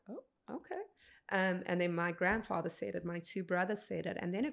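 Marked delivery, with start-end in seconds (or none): 0.1-0.8 high, soft, stunned little "okay"; caught off guard. Retold or imitated. Recalling her reaction to mom forgiving the killer, high startled okay. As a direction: squeak it out like you can't believe what you just heard.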